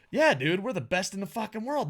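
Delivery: lightly hammed-up cocky promo; mock bro hype. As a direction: brag like a pumped-up surfer dude.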